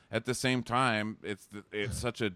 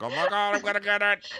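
high, loud mock-excited squeal; hammed-up excitement; 11 semitones higher, 4 dB louder than usual. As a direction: squeal it like an overexcited contestant. Acting out excited Bobblehead contestant.

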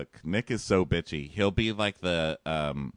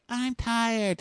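put-on much higher sleepy girl voice; mock sleepy girl; 13 semitones higher than usual. Host imitating Amanda brushing Josh off, high sleepy voice. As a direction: mumble it like a drowsy girl.

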